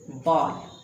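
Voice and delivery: बॉल with an open aw vowel, not बाउल; बॉल said short as bɔl; correct ball. Shown as a demonstration. Teacher models the correct बॉल, like the ball you play with. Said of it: "सही उच्चारण होगा".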